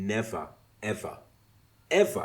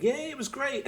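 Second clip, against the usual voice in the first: high-pitched imitation of a gushing co-host; mock enthusiasm; 4 dB louder than usual. Co-host agreeing on a podcast, mock enthusiastic. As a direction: gush along like a podcast pal.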